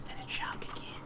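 hushed villain whisper; sinister whisper. Acted. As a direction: breathe it like a cult leader. Ominous stream intro bit.

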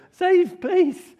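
very high, loud desperate cry; squealed fan's plea; pleading shout. Shown as a demonstration. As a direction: beg for rescue at the top of the voice. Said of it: "It's both kind of going".

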